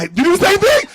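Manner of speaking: delighted shock at a fellow troll; surprised. Real, not acted. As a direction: shoot it up like you caught someone. Surprised question to an audience member.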